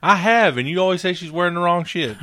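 loud, high defensive retort; heated protest. Real, not acted. Heated bickering over morning chores.

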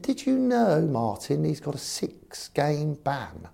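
put-on high posh accent, slowing at the end; mock a posh chairman's pointed question; Eddie Rogers. Chairman asks manager about a ban, mock posh high voice. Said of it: "'cause very posh, Eddie".

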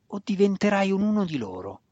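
high, biting growl. A narrator's reading. Weapon master growls a warning at Drizzt. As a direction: spit the warning out. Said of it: "ringhiò Zack".

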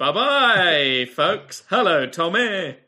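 put-on loud, slow, pompous old critic voice; pompous theatre critic. Theatre critic greeting David, pompous and loud. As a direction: play the grand theatre critic. Plays character Michael Homeal.

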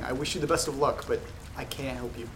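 usual speaking voice

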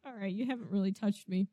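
low, soft musing; quiet, understated in-character remark; creature's muted aside. Acted. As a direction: voice a monster sizing someone up under its breath. GM voices the lizard's thought.

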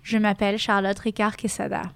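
French pronunciation, name included; French version. Shown as a demonstration. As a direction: introduce yourself as a French speaker would. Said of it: "I'll say it in the four languages".

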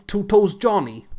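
high, loud New York wiseguy voice; New York mobster impression, hammed up; New York gangster accent. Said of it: "That's the accent you're trying to do".

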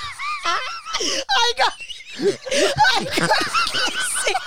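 squealing high through helpless laughter; barely getting the words out for laughing; cracking up mid-story, words squeezed out between laughs; laughing fit. Real, not acted. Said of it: "that's us going into a fit of laughing".

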